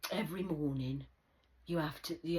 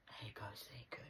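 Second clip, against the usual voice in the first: whispered aside; 5 semitones lower, 12 dB quieter than usual. Real, not acted. Quiet aside.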